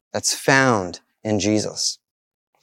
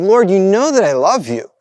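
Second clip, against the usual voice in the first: retold exasperated plea, higher pitched; frustrated; 6 semitones higher, 3 dB louder than usual. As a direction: voice a frustrated disciple insisting. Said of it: "And Peter, frustrated, saying".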